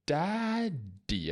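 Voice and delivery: "daddy" modelled high and clear, falling; teacher modelling "daddy" for small kids; correct model. Shown as a demonstration. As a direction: say "daddy" brightly, the right way. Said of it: "correct them together".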